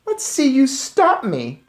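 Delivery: put-on smug taunting boy. A narrator's reading. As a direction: dare him like a bully. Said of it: "he said".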